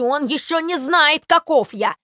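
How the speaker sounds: loud, emphatic acted rage; indignant, worked-up shouting; furious yelling. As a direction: rant and threaten as an outraged sparrow. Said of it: "орал Воробей Воробеич, задыхаясь от ярости".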